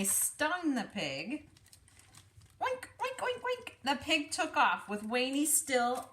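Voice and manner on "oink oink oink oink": pig squeals. Acted.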